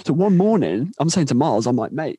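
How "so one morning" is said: ordinary delivery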